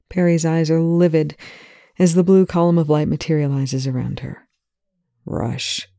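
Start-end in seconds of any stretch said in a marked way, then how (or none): 5.2-5.9 hushed, breathy name; low voice. A narrator's reading. Young quietly calling Rush's attention, low. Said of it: "Young's voice is low".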